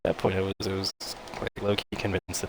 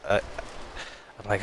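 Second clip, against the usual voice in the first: usual speaking voice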